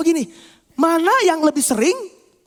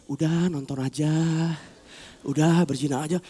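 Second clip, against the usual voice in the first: small tempter voice; 9 semitones lower, 6 dB quieter than usual. Acted. Starved tempter nudging the soul, low and small. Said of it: "dia makin kecil".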